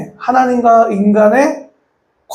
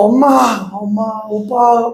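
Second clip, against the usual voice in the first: calling family; slower than usual. Retold or imitated. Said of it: "막 이렇게 했는데".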